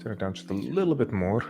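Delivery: usual speaking voice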